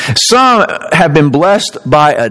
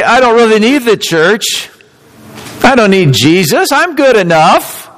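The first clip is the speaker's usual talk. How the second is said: smug worldly voice; 6 semitones higher than usual. Retold or imitated. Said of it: "then you could say".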